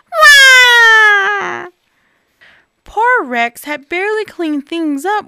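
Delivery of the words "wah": high, loud crying wail; bear cub wail. Acted. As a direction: bawl like a baby bear. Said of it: "cried Baby Bear".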